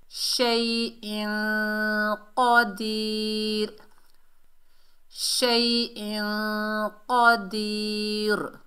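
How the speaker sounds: very slow, held sounds on level pitch; measured recitation. Shown as a demonstration. Teacher models the words, slow recitation. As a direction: hum the n long, stretch the last vowel.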